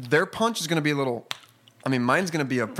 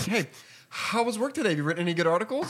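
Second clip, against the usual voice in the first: higher, chirpy in-law; 6 semitones higher than usual. Retold or imitated. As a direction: ask it like a polite relative. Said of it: "He's like".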